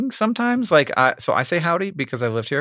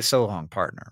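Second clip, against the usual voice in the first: cowboy drawl; 7 semitones lower, 6 dB quieter than usual. Shown as a demonstration. Showing the better cowboy goodbye, low soft Western drawl. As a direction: lower and softer, a Western twang. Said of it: "it's better if you say".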